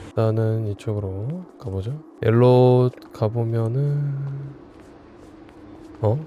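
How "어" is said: ordinary talk, nothing marked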